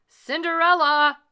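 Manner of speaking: loud high call; calling loudly. A narrator's reading. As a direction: call her down from upstairs. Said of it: "called the stepmother".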